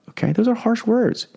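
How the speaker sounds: ordinary delivery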